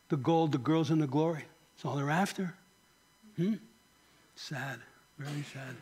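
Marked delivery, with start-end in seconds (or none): 4.3-5.7 hushed, low lament; sorrowful aside. Real, not acted. Preacher grieving over celebrity Christians, quietly sorrowful. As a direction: drop to a soft, low, grieving murmur.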